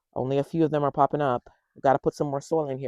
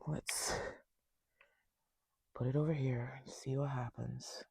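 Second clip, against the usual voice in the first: hushed whisper; 10 dB quieter than usual. Real, not acted. Upset over ruined onions.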